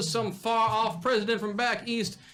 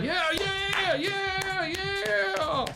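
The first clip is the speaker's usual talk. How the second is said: high crowd cheers; cheering crowd; 9 semitones higher, much slower than usual. Acted. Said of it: "And the crowd".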